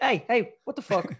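high, loud startled yelp; startled yell. Retold or imitated. Comedian retelling being poked by a stranger, startled yell. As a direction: yelp it like someone just jabbed you. Stranger poking him in Singapore.

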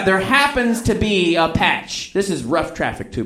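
ordinary talk

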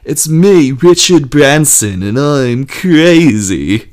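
loud, high, slow and laughing; manic bully. Pretend. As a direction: crow it out like a maniac. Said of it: "is like".

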